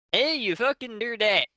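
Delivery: hammed-up yelling goth; mock yell. A narrator's reading. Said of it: "yelled the other deaf elite".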